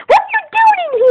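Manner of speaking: high, loud startled question. Real, not acted. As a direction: jump at the intruder. Sibling barges in.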